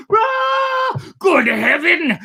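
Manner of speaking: high, loud, shocked cry; horrified exclamation. A narrator's reading. Seeing the mate's boat smashed, loud horrified cry. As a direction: cry out in horror at the wreck. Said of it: "I exclaimed".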